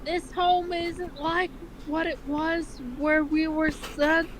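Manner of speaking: hammed-up cartoon mom reassuring her son; mother's voice. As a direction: read Ma a pitch up with a motherly lilt. Reads Ma's dialogue.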